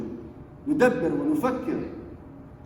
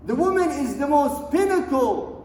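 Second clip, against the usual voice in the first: fiery preaching; 6 semitones higher, 4 dB louder than usual. Acted. Imam urging the women of the world, impassioned preaching. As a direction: preach it out, stretching the key words.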